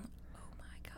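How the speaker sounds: hushed whisper aside. Real, not acted. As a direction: whisper it under your breath.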